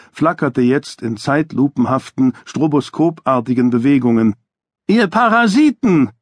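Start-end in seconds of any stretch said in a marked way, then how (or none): 4.9-6.1 much higher, shrieked insult; boy's scream. A narrator's reading. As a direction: hurl it at the photographers like a furious kid. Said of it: "schrie Ira".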